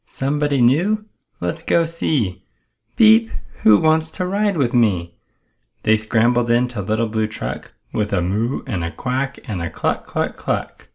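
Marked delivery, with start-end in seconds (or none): 0.1-5.1 high, bright-eyed little truck. A narrator's reading. Little Blue Truck asking the animals, high voice. 9.7-10.9 lightly higher clucks; a hint of the hen in the narration; hen clucking. A narrator's reading. Narrator echoing Hen's clucks, lightly higher.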